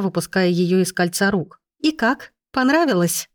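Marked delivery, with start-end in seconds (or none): 1.8-3.3 high-pitched scornful questions; disgusted. A narrator's reading. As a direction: ask with revulsion, pitched well up. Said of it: "скривившись в отвращении".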